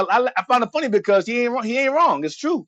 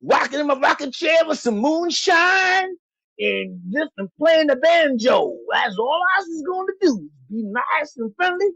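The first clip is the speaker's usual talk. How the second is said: minstrel act; 3 semitones higher than usual. Acted. Playing a subservient minstrel figure.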